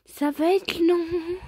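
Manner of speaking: long-suffering, stretched delivery; drawn-out complaint. Real, not acted. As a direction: drag it out, dreading the long haul. Dreading the long chapter left.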